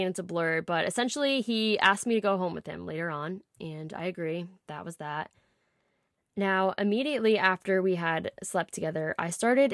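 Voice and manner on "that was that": soft, falling, resigned; quiet resignation. Acted. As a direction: let it drop quietly, matter-of-fact. Resigned end of the night.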